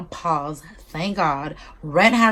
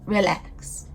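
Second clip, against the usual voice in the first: sassy relax; 3 dB quieter than usual. Retold or imitated. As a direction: snap out "relax." as a sharp, falling put-down. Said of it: "Like, bitch".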